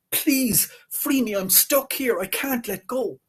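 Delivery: high desperate begging; high pleading. Acted. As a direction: plead shrilly to be freed. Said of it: "he started shouting".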